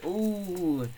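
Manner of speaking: high, drawn-out mock co-host; Richard Miller impression. Teasing the co-host to his face, high put-on voice. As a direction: send up a friend in a high voice. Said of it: "do an impression of me".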